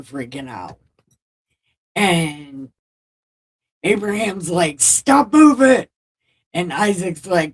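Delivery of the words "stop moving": high loud bark of a command; dad yelling at his squirming son, loud and high; mock yell. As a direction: snap the order up high and loud. Father yelling at struggling son.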